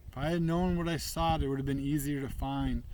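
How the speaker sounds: usual speaking voice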